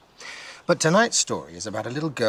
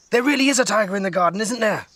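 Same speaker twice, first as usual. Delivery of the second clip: excited; 10 semitones higher, 5 dB louder, faster than usual. A narrator's reading. Nora excited at dinner.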